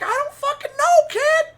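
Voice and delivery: very high, loud squawk; hammed-up high-pitched protest; imitating Quincy. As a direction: yell back in a mock falsetto. Retold Quincy yelling back.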